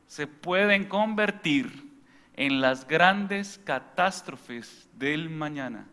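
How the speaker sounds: slow, weighty sermon delivery; slow preaching refrain. Acted. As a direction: drive each word home slowly.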